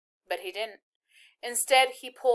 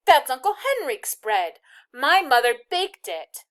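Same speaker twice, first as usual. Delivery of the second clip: higher, louder, slowing at the end; indignant; 5 semitones higher, 7 dB louder than usual. A narrator's reading. Girl scolding the soldier, loud and high. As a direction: scold him for the bread. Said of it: "she said angrily".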